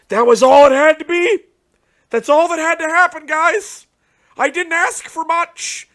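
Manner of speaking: loud, high exasperated outburst; frustrated rant. Real, not acted. Ranting at Konami over a letdown card, loud and high. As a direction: let the disappointment blow up at them.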